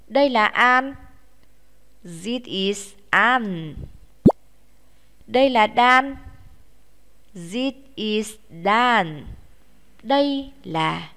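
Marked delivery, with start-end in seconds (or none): 2.0-3.7 slow, careful English drill; slow, clear classroom model; slow model sentence. Shown as a demonstration. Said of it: "Các em nói thế nào?". 7.3-9.3 slow, careful English drill; slow, clear classroom model; slow model sentence. Shown as a demonstration. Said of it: "Các em nói thế nào?".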